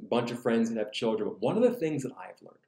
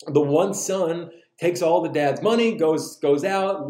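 usual speaking voice

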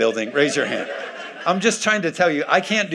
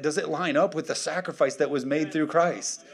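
ordinary delivery

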